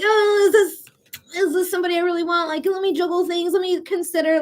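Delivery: half-playful hmm, do I want this; hesitant musing. Retold or imitated. Reader acting out a wary Taurus-style hesitation, musing. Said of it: "being me. Uh, being like".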